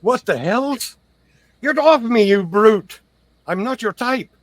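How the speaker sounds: high, loud outraged squawk; indignant protest. Acted. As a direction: play the affronted victim being manhandled. Acted protest at being shaken.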